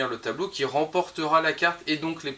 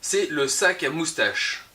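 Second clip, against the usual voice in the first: usual speaking voice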